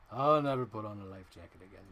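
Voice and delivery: a hint of a grizzled old sea captain; Quint. A mild Quint voice as she places his figure.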